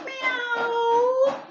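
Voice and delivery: impatient meow. Acted. Cat scratching at the door, high insistent meow. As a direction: mew urgently to be let in. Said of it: "he said again and scratched impatiently".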